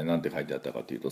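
ordinary talk, nothing marked

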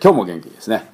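ordinary delivery

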